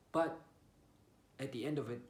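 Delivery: ordinary delivery, nothing marked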